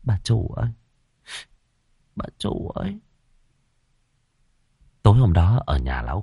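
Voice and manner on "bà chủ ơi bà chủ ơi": soft, sighed call to the mistress; wistful, hushed sighing appeal. A narrator's reading. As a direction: let the call out like a sigh. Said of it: "Nó thở dài".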